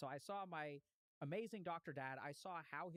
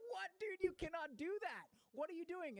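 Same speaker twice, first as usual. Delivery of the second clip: much higher, louder shocked outcry; shocked disbelief; 13 semitones higher, 4 dB louder than usual. Retold or imitated. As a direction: blurt it out like a room of stunned students. Room shocked at seed eating.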